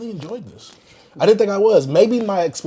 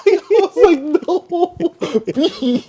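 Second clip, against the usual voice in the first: high, squeaky laughing disbelief; laughing shock; 13 semitones higher, 4 dB louder, much slower than usual. Real, not acted. Host reacting to the verse, laughing high.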